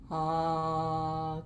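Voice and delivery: low, easy ah; low sound. Shown as a demonstration. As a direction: sing a low ah. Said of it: "I want you to make a low sound for me".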